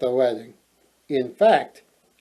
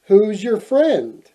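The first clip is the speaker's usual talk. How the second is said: suspicious; 6 semitones higher, 4 dB louder, slower than usual. A narrator's reading. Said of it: "eyeing the prince suspiciously".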